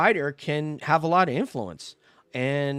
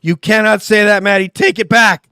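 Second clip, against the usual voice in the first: mock outrage; 6 semitones higher, 12 dB louder than usual. Co-host teasing Maddie for criticising an Australian, loud mock outrage. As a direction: pretend to be offended, loudly.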